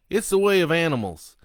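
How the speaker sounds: loud declaration. Acted. Killer Sloan lecturing the priest, near-shouting. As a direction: bellow it like a creed.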